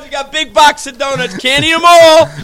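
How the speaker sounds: loud, high pitch barking donuts to a crowd; mock vendor call. Host pretending to sell donuts to fans, loud vendor call. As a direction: hawk free donuts like a boardwalk vendor. Hawking free donuts at the show.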